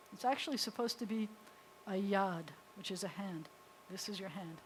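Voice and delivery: ordinary talk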